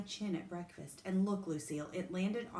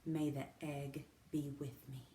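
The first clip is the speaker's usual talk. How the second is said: solemn hush; 3 semitones lower, 5 dB quieter than usual. A narrator's reading. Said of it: "Sheldon swallowed hard".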